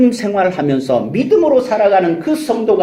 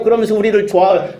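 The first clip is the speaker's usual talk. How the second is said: normal talking voice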